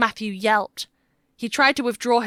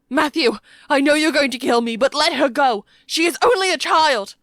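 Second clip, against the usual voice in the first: high, loud, urgent begging; desperate plea; 6 semitones higher, 7 dB louder than usual. A narrator's reading. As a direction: shout a plea for a child's life. Toby pleads for the child.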